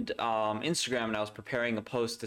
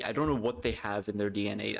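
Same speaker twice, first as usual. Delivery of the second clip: ordinary delivery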